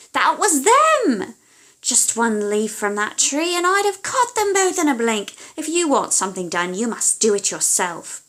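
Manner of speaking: shrieking witch fury; furious witch. Acted. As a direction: explode with rage at her daughter. Witch rages, goes herself.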